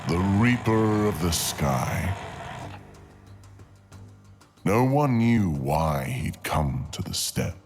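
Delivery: ordinary delivery, nothing marked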